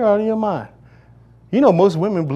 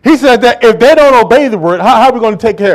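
preaching at force; 5 semitones higher, 7 dB louder than usual. Real, not acted. Preacher reads verse loudly.

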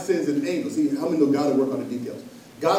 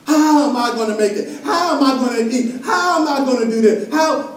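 higher, laughing mock of a worried believer; doubting believer; 7 semitones higher, 5 dB louder than usual. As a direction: play the hesitant giver, half-laughing. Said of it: "y'all know what I'm talking about".

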